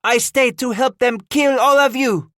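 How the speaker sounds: very high, loud defiant cry; screaming. A narrator's reading. The matriarch vows to fight beside her sons, screaming. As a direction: scream your defiance at them. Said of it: "she screamed".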